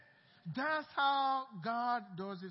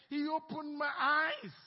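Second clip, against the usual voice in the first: He opened my "eyes" slow, louder, landing hard on "eyes"; stick it to them; 5 semitones higher, 6 dB louder than usual. Shown as a demonstration. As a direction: drive home "eyes" slowly and firmly. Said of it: "Stick it to them".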